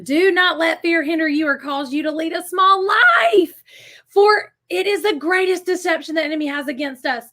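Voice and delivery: loud, emphatic preaching read; proclaimed card reading. Acted. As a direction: declare it with rising force, like a rallying cry.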